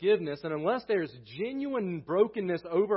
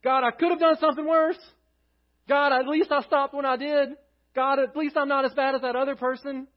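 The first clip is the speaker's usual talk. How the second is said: louder, higher pleading list of excuses; a hint of a self-justifying sinner pleading louder; pleading bargainer; 8 semitones higher, 6 dB louder than usual. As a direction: bargain with God, excuse after excuse. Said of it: "David does not come before God saying".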